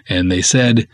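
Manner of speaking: ordinary talk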